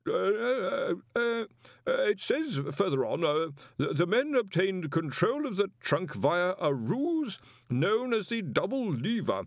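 timid professor forced to read; nervous stammering reader. Acted. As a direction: stumble nervously through the pages. Nervous professor reading aloud.

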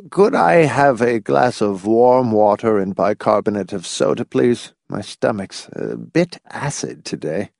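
put-on fussy, frail elderly figure; frail old man. Sylvester asking for stomach soda, frail old man.

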